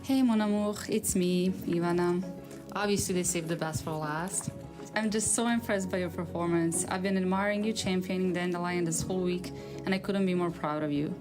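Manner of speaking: ordinary talk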